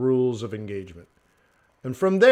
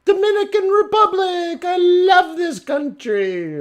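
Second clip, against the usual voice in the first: very high, loud put-on squeal; gushing tourist; 6 dB louder than usual. Imitating a tourist praising the wrong country, mock squeal. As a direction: squeal it slowly in mock delight.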